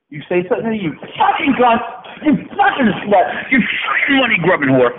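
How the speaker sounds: unleash a mock-furious rant, pitch way up; angry rant. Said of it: "But you're gonna see it now".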